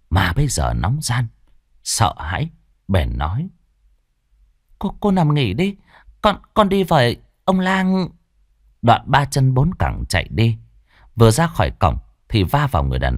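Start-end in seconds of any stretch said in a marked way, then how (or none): 4.8-8.1 put-on high, anxious young maid; high servant voice, worried; servant girl voice. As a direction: fret over her fallen mistress.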